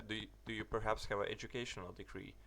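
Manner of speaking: ordinary talk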